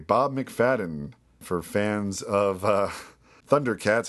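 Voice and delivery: ordinary talk, nothing marked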